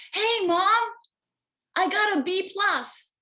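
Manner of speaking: put-on high, loud kid voice calling to mom; eager child. Child calling out to mom about his B plus, high and eager. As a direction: play the excited kid showing off his grade.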